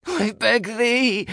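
high, loud, drawn-out scream; piercing, stretched-out begging. A narrator's reading. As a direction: scream the plea to the gods. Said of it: "I screamed".

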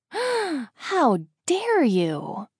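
high, very slow, hammed-up indignation; playfully scandalized, dragging out every word; mock outrage. A narrator's reading. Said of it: "She gasps and then giggles".

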